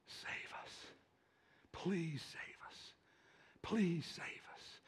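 slow, hushed whisper; whispered plea. Acted. Preacher voicing what the crowd was asking, whispered plea. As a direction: plead it under your breath, drawn out.

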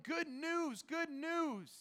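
high, loud shout of good news; messenger yelling. Retold or imitated. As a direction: shout it across the city. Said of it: "he's yelling".

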